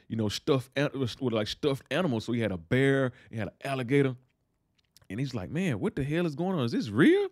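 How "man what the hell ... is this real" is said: high-pitched disbelieving question; imitating someone freaked out and baffled; acted bewilderment. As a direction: rise sharply in startled disbelief. Acting out Franklin startled by stuffed animals.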